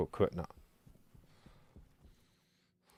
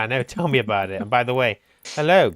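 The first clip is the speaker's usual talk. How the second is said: ordinary delivery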